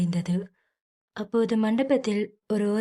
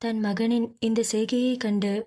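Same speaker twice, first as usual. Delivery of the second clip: usual speaking voice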